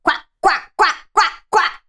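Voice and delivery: muffled duck quacks; duck quacking. Acted. The duck quacks from inside the wolf's belly, muffled quacking. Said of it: "the duck quacking inside the wolf".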